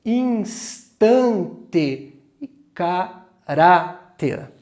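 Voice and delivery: Instante, caráter in slow beats, hitting TAN and RÁ; stress on penultimate syllable. Shown as a demonstration. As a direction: split the syllables and lean on TAN and RÁ. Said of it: "A penúltima é tônica".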